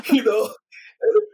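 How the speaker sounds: high loud laugh; amused laugh. Real, not acted. Laughing at the absurdity.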